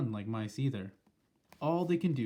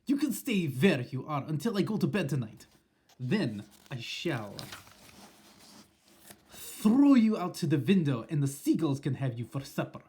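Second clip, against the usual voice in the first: Grand High Witch; 3 semitones higher, 5 dB louder than usual. Acted. Witch gloating at the trapped frogs, loud and menacing. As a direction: croon a threat like a gloating witch. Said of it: "I heard her saying".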